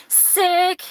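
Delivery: high, bright exclamation; excited. Real, not acted. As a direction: cheer it out. Excited reaction to a resonant reading.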